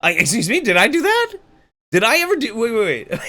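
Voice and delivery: high, loud mock indignation; shrill stunned disbelief, hammed up; mock outrage.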